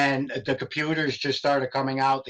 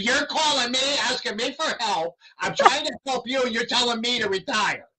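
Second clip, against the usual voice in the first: heated, pitch raised, scolding the caller; angry rant; 8 semitones higher than usual. Retold or imitated. Scolding the caller on the phone, heated rant. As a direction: tear into her for the insult. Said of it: "mother effing her up and down".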